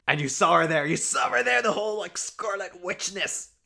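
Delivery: building thrilled exclaiming; excited. Real, not acted. Excited about costume.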